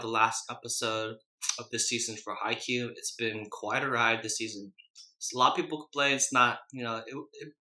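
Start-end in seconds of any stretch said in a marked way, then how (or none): none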